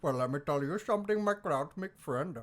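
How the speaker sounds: put-on Indian-accented mobster, slowing at the end. Actor finishing his Godfather line for co-hosts, Indian accent. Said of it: "in an Indian accent".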